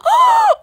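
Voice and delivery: short, very high, loud gasp; overjoyed gasp. Pretend. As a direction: gasp sharply in hammed-up amazement. Overjoyed at pets in trade offer.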